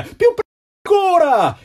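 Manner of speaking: very high, loud urgent shout; guard shouting. A narrator's reading. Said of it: "gridò la guardia". (grido urgente della guardia, molto acuto e forte)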